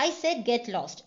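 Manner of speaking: a touch of anger, said slowly; lightly voiced angry shopkeeper. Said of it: "വളരെ ദേഷ്യത്തോട് പറയാണ്".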